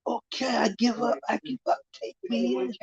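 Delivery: panicked giving up. Retold or imitated. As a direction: give up in a scared rush. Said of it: "And he says".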